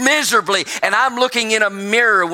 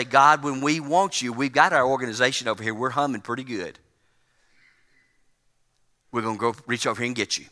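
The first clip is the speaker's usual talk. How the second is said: offhand mock prayer; 6 semitones lower, 5 dB quieter, faster than usual. Mimics complacent church addressing God.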